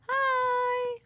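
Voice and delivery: loud high cheerful hello; bright greeting. Pretend. Greeting a shopkeeper.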